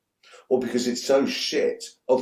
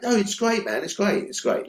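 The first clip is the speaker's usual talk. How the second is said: a mock-patronizing pat on the head, pitched higher; 9 semitones higher than usual. To Tarantino about Kill Bill, mock patronizing. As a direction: humour him, like praising a child's drawing. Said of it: "Like, really patronizing".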